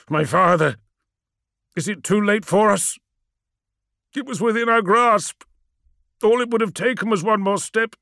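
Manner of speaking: high, strained lament; broken phrases of grief with long pauses; anguished. Acted. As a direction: grieve aloud for what was lost. Magnus in anguish, sinking down.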